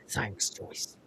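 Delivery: hushed deadpan thanks; flat whispered thanks. Retold or imitated. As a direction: murmur it under your breath. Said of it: "in exactly the same way that he did before".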